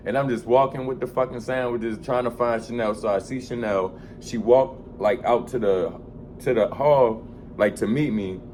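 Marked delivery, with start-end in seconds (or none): none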